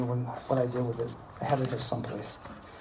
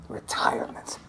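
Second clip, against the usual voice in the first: whisper; 4 dB quieter than usual. Acted. Scoffing at the idea of retiring.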